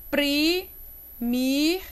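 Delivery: PRI and MIR drawn out and separated; teacher splitting the word; syllable by syllable. Shown as a demonstration. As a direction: scan it slowly in two pieces. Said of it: "Como é que eu separo?".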